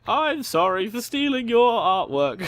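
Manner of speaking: high, slow sarcastic sorry; mocking apology. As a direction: taunt with a fake apology. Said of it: "got a kick out of ... being like".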